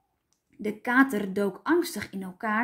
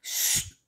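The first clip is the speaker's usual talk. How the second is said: unvoiced "sst" hiss; short hushing sound; shushing; 4 dB quieter than usual. A narrator's reading. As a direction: hush the noisy room. Father hushing the children.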